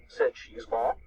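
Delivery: ordinary delivery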